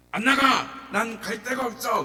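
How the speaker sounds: loud, high stubborn refusal; defiant shout. Retold or imitated. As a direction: dig in and yell no. Demon refusing exorcism.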